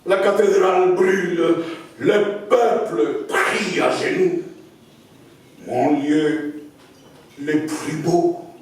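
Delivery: slow declamation with long pauses, swelling from hushed lines to high cries; dramatic poem recital. Acted. Poet reads his Notre-Dame fire poem to an audience, dramatic declamation. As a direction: recite it in incantatory waves, near-whisper rising to ringing intensity. Je vais lire ce, ce poème.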